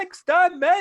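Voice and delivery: hammed-up Dalek screech. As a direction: shriek it like a Dalek. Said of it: "really over the top".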